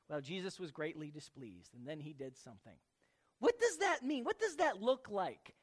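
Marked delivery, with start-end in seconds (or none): 0.1-2.8 low, soft throwaway reading; offhand skimming. Acted. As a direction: gloss over it quietly. Mimics glossing over the verse.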